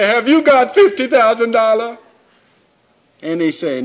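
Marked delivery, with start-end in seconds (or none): 0.0-2.0 high, loud, eager question; Michelle's voice. Acted. Michelle asking Paul about his money, high and loud. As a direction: ask it brightly, as a punchline.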